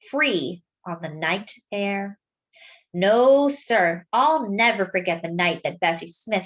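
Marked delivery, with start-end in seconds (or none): none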